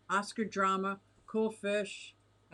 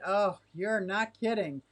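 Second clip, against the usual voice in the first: ordinary talk, nothing marked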